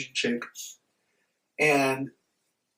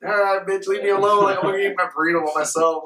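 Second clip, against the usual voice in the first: louder, higher retold brush-off; mock telling-off to get rid of them; annoyed brush-off; 5 semitones higher, 4 dB louder than usual. Telling pushy strangers to leave.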